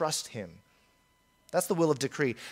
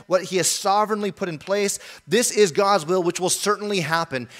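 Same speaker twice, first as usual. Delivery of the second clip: normal talking voice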